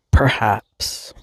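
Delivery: ordinary talk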